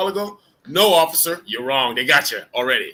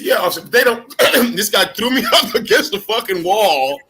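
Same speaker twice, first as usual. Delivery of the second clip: louder, slower, heated telling of the takedown; mock outrage, raised voice; loud outraged retelling; 6 semitones higher, 7 dB louder than usual. Veteran telling friends about the arrest, loud and heated. Retelling being slammed by cop.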